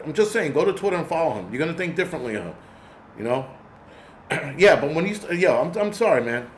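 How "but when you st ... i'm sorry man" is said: ordinary delivery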